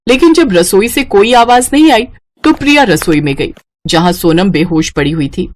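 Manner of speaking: ordinary delivery, nothing marked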